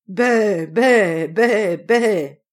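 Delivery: loud, drawn-out imitated bleating; storyteller's forceful goat cries. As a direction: bleat loudly like a protesting goat.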